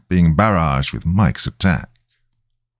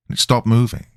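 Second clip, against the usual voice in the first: usual speaking voice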